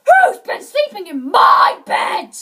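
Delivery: huge, loud roaring shout; great big bear voice. A narrator's reading. Father Bear at his bed, great big voice. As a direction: thunder it like an enormous bear. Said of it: "he said in his great big voice".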